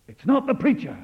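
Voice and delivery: sudden loud, high declaration; preacher's ringing denial; emphatic outburst. Acted. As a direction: cry it out to the whole hall.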